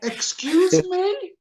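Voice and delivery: high, drawn-out indignant question. Retold or imitated. Customer retorts to curt drive-through worker, indignant. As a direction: act taken aback, pitch way up. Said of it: "And I go".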